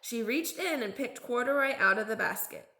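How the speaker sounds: ordinary delivery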